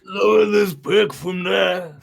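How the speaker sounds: rough gravel-voiced shout, slow; gravelly voice. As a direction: bark it out gritty and strained. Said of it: "with the most gravelly voice you can".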